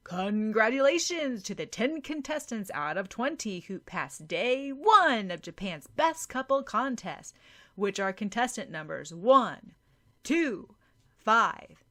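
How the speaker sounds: loud, bright emcee calling out results; contest MC announcement. Acted. MC addressing contestants and audience, loud announcer voice. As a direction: announce it like a host on stage.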